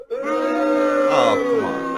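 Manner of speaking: a hammed-up groan at his own pun; mock groan. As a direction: groan up high like a heckler. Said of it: "it's a tough room".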